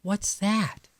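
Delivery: high, eager little question; a small kid wanting to know; curious child raccoon. A narrator's reading. Chester asking his mother what it is, high and eager.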